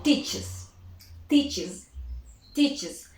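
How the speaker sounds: "teaches" with a clear added "iz" syllable; teacher drilling "teaches" slowly; extra syllable iz. Shown as a demonstration. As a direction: spell out "teaches" in two clear beats. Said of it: "Teach becomes".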